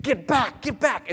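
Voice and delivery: high, urgent acted command; fierce warning. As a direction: drive the beasts off, protective. Man defends fiancée from lions.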